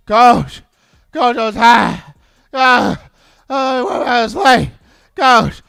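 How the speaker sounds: loud, breathless, begging player's voice; imitating a gassed player pleading with the coach; worn-out player.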